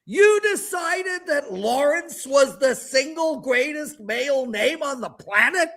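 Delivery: high, slow, half-laughing disbelief; incredulous laughing rant. Real, not acted. Host railing at his parents over his name, incredulous. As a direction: rant at the absurdity, near tears of laughter.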